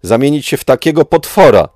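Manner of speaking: a high, loud anguished shout; a furious cry from a betrayed friend. Acted. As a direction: scream it at him in despair. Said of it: "krzyknęła".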